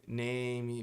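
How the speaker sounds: Name with an extra vowel, "neimi"; wrong name. Shown as a demonstration. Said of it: "fica muito feio". (name errado, "neimi")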